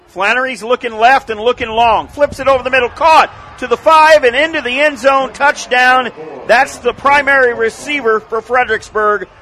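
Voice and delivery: building, loud play-by-play shout; touchdown call. Real, not acted. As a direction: call the touchdown pass live. Touchdown pass called live.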